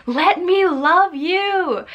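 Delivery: high, loud drawn-out yell. Acted. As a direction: yell it out slowly, high-pitched and pleading. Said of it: "just yelling".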